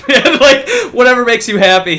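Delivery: high, loud, laughing it off; laughing while brushing it aside. Real, not acted. Retelling how he humored the Russian guy, laughing. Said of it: "laughs during retold shrug".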